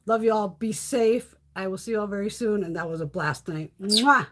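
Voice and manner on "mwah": loud smacked kiss; loud "mwah" kiss sound; blown kiss. Real, not acted. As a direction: send a big audible kiss goodbye. Blows a kiss signing off.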